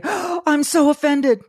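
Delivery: high, slow, gasping mock outrage; mock offended gasp. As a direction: gasp and whine like you're scandalized. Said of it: "If you say".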